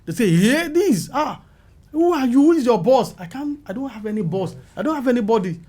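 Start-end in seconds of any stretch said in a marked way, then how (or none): 0.4-3.1 higher, pointed mock questioning; questioning newcomer. Producers grilling the newcomer on set, in a mock pointed questioning voice. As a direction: imitate people quizzing a stranger. Industry people questioning him.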